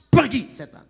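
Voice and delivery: explosive high command, falling away; commanding shout. Retold or imitated. Pastor ordering a demon out through the door, sudden loud command. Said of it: "kau perintahkan dalam nama Tuhan Yesus".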